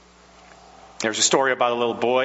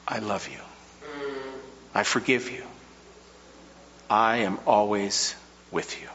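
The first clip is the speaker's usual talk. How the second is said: hushed, softer tender reassurance; a quieter echo of a loving God; God's tender promise; 4 dB quieter than usual. Acted. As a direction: let each promise fall gently, almost breathed.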